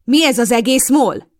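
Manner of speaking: loud, high, slow demand; slow furious shout. A narrator's reading. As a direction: demand an answer in fury. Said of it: "villámló tekintettel".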